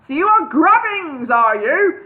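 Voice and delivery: high loud shout. Acted. Wizard shouts at the hobbit, high and loud. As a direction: bellow the accusation. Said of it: "he shouted".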